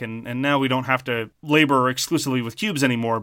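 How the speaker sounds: ordinary talk, nothing marked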